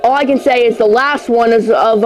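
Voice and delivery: ordinary delivery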